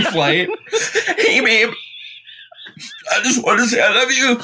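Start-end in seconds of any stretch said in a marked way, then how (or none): 1.2-4.4 high, quick goodbye to a loved one; hurried farewell over the phone; tearful voicemail. Retold or imitated. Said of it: "emergency voicemail for your loved one".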